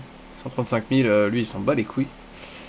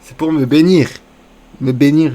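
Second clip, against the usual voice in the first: loud declaration; 2 semitones higher, 9 dB louder than usual. Real, not acted. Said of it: "Je me suis fait un bisou".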